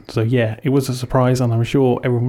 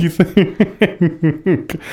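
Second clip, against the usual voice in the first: amused question broken up by laughter; laughing; 5 semitones higher than usual. Real, not acted. Teasing co-host about hearing air horns, laughing. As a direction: laugh through the words, higher.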